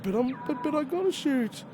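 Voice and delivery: high pleading whine rising to a squeak; whiny protest. Retold or imitated. Answering Venom's telling-off, a high mock whine. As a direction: beg like a kid who can't give up. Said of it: "And I'll be like".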